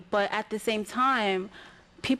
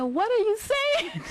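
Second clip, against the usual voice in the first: high, slow, baffled mother voice; puzzled mom; 13 semitones higher, slower than usual. Retold or imitated. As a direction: stretch it out like a confused mom. Mom puzzled by deep poem.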